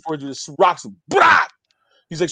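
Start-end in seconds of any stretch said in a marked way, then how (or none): 1.1-1.4 loud, high burst of a blow; imitated punch impact. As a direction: blurt out the smack of a punch.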